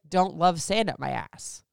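ordinary talk, nothing marked

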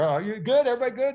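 high, loud cheery hello to the courtroom; mock-sunny good morning to the court; breezy cheerful greeting. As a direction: breeze in like you own the place. Acting his cheerful court entrance.

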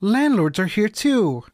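high, slow, mock-cheerful disgust; sarcastic. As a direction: sneer it as fake delight. Sarcastic reaction to landlords.

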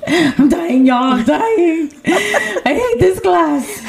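high, slow wail breaking into laughter; laughing, drawn-out melodramatic panic; mock anxiety attack. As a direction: wail it like a hammed-up meltdown. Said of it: "felt like I had an anxiety attack".

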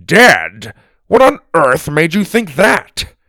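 loud, indignant disbelief; astonished outburst. A narrator's reading. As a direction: burst in, appalled by the idea. Grandpa jumps in, astonished.

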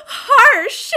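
high, loud mock wail; fake crying. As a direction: gasp then cry out "harsh" in mock grief. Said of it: "Somebody's gonna be like".

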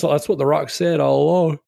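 usual speaking voice

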